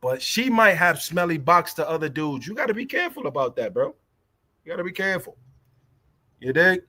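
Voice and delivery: normal talking voice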